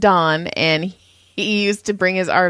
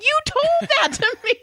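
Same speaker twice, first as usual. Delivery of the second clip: much higher, incredulous protest; exasperated. Retold or imitated. Telling the sleepy engineer he explained it himself, high and incredulous. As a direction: throw it back at him in disbelief. Exasperated retort to forgetful friend.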